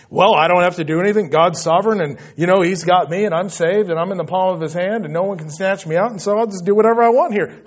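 fast, glib run-on imitation; mock complacency. Preacher mimics a believer excusing doing whatever he wants, glib. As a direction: rattle it off as a careless excuse. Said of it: "Please don't hear, hear what I'm not saying. It's not".

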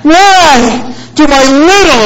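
imitated high, drawn-out childish protest; devastated boy. Son protests to dad about his younger siblings, mock devastated. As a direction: wail it like a hard-done-by little kid. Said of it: "he's emotional, and there's tears in his eyes".

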